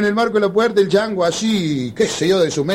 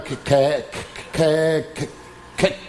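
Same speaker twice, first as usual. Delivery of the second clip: a hint of a stammer; light mock stutter, as if water in the mouth; stuttering friend; 3 semitones lower, 5 dB quieter, much slower than usual. Friend struggling to speak, mild stammer. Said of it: "Tenía problemas para hablar".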